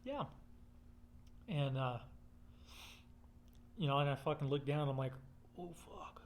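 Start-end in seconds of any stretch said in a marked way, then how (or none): 5.6-6.2 a sudden hushed gasp of alarm; startled whisper. Retold or imitated. To himself on seeing the rattler, a startled whisper. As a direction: breathe it out as you freeze.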